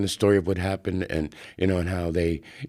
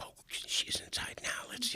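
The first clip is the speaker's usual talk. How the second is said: 1 whisper; 14 dB quieter than usual. Retold or imitated. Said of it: "whispered setup".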